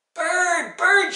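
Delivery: high, loud shouts after the bird; urgent, piercing call; yelling. A narrator's reading. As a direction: holler up into the sky. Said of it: "she yelled".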